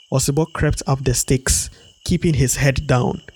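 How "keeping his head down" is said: plain reading voice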